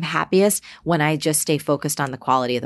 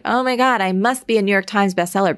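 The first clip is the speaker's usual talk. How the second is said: higher, louder "Oh my God" in mock panic; mock desperate ambition; 6 semitones higher, 3 dB louder than usual. Mocking herself as desperate for the bestseller list, higher mock panic. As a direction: parody a bestseller-hungry author. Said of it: "not because I'm like".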